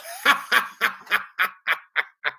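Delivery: a slow villainous cackle; dark lord's gloating laugh; evil cackle. Acted.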